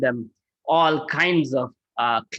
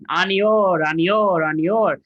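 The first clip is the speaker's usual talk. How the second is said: louder, chanted call for more food; hungry deity's call; 2 semitones higher, 3 dB louder, slower than usual. Retold or imitated. Krishna as Govardhan calls for more offerings, loud and chanted.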